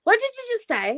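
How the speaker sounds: high-pitched, prim correction; scolding older sister. Retold or imitated. Older sister corrects youngest's pronunciation, prim scolding. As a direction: imitate a sister correcting your speech. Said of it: "my sister would stop me and be like".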